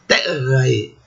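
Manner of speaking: "terrae" with stressed first syllable and separate A-E; classical pronunciation. Shown as a demonstration. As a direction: say "terrae" as TER-ra-e, rolling into a short ending. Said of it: "Esse final ae".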